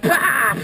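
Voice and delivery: loud, high burst "pah"; explosive imitated spray; water blast. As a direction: spit it out like a cannon shot. Imitates griffin firing water cannon.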